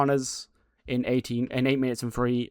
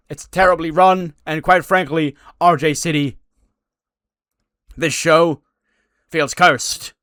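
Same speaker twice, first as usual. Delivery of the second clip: loud complaint; 5 semitones higher, 10 dB louder, slower than usual. Retold or imitated. Johnny complaining to the GM.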